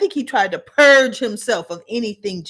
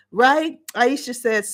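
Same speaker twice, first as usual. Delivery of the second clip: ordinary talk, nothing marked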